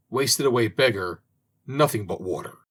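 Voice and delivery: ordinary delivery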